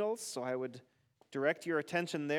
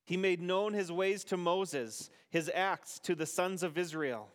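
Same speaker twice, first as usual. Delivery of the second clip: responsive psalm reading. Acted. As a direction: lead the psalm and leave room for the congregation.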